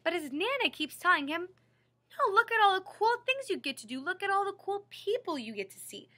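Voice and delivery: usual speaking voice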